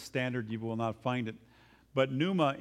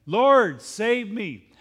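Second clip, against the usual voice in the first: loud, desperate cry to be saved; crying out for help; 6 semitones higher, 10 dB louder, much slower than usual. Acted. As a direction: shout it as if sinking under the waves. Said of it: "he cried out".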